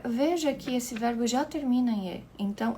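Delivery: ordinary delivery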